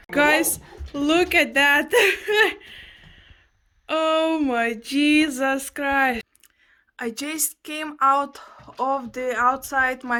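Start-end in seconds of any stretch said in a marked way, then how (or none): 0.1-6.2 slow, worn-out laughing words; exhausted laughing. Real, not acted. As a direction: laugh through the exhaustion after hauling. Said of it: "I'm flippin' dying already".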